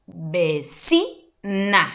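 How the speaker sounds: "vecina" broken up, ve-CI-na, middle syllable stressed; stress on penultimate syllable. Shown as a demonstration. Teacher showing pupils the tonic syllable, ve-CI-na in "vecina". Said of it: "Sílaba tónica ubicada en la penúltima".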